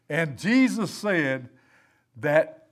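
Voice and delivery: ordinary delivery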